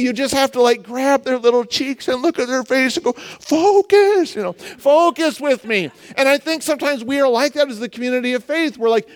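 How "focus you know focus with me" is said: high, drawn-out plea for attention; insistent parent. Acted. Parent to distracted kid held by the cheeks, insistent. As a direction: stretch it out to get through to a child.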